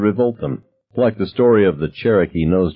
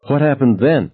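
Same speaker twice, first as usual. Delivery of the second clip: breathless; 6 semitones higher than usual. A narrator's reading. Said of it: "asked breathlessly".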